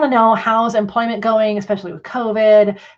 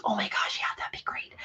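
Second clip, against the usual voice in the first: retold whispered delight; 14 dB quieter than usual. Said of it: "She goes".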